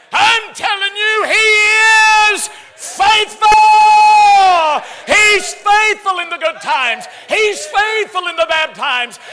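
high, roaring, drawn-out preacher shout; full-force preaching. Real, not acted. Preacher telling the congregation He is faithful, shouting at full force. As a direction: hammer each phrase at the top of your voice.

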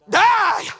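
high, loud stage cue; shouted command. Acted. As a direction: bellow it at a slow scene partner. Orders helper to act death.

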